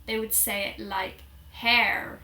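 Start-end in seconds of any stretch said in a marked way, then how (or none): 1.5-2.2 "hair" in an American accent with a sounded R; exaggerated American "hair", hard final R. Shown as a demonstration. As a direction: imitate an American saying "hair", R pronounced. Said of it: "Americans would say this very differently".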